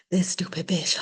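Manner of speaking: whispered mock grumbling; muttered retold grumble. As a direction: curse them under your breath, in a whisper. Mallory grumbling as she gets up.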